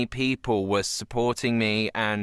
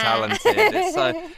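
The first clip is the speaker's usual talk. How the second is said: gushing; 4 dB louder, slower than usual. Real, not acted. Gushing praise, high.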